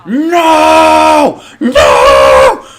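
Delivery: high, loud tantrum screams; screaming like a lunatic. Retold or imitated. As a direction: scream it like a kid losing his mind. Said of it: "screaming my head off like a lunatic".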